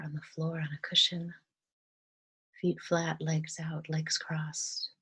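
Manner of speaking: soft, calm meditation voice with long pauses; guided meditation. Real, not acted. Guide leading listeners through breathing and gratitude, softly meditative. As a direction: gently guide them into relaxation, leaving space between phrases.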